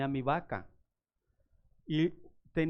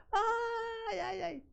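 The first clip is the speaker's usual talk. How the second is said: high, panicked ay ay ay; imitating a scared old lady squealing; frightened cries; much slower than usual. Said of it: "y la señora". (chillido asustado de la señora)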